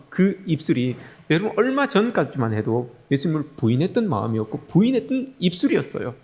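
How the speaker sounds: usual speaking voice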